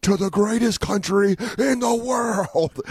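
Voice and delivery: high, slow, hammed-up heel taunt; wrestling heel. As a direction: taunt the crowd like a wrestling villain. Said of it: "That's when you start healing it up".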